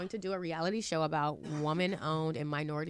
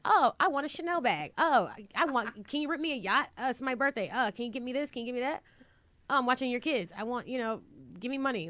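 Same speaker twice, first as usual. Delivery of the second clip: higher, louder, whiny list of demands as mom; mock demanding mother; 6 semitones higher, 4 dB louder than usual. Daughter mimics her mother begging for gifts, teasing and whiny. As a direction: play the greedy mom asking for a yacht.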